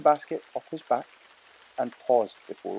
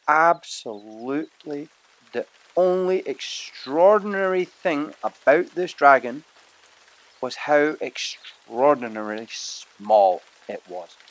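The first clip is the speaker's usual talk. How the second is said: drawn-out words, louder, for effect; slow emphatic narration; 3 semitones higher, 6 dB louder, slower than usual. A narrator's reading. Narrator drawing out the dragon's tiny size, slow and louder. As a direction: savour the irony slowly.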